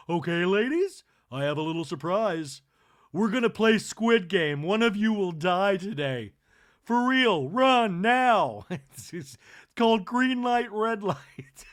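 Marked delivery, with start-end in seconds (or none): 0.1-8.6 loud, high hostess announcing a game; playful Kathy. Retold or imitated. Kathy teasing her guests, loud and high. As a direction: boom out the surprise like a party host. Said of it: "Kathy goes".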